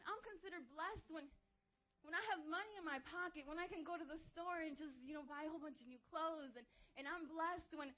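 ordinary delivery

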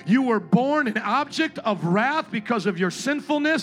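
loud, driving altar-call preaching; preaching at full force. Acted. Pastor urging the congregation at the altar, full-force preaching. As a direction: press the crowd toward the altar with urgency.

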